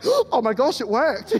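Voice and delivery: loud, high amazed cry; loud laughing amazement. Retold or imitated. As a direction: blurt it out in shocked delight. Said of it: "the preacher was like".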